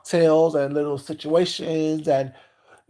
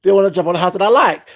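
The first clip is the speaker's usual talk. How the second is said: loud, higher, fast accusation; angry complaint; 5 semitones higher, 8 dB louder than usual. Retold or imitated. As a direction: complain hotly about the husband. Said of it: "I'm like".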